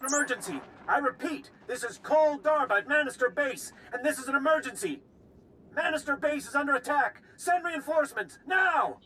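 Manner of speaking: high, forceful cry for reinforcements; urgent distress call. Acted. Base officer calls for help under attack, urgent alarm. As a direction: raise the alarm, pitched far up, peaking on a shout.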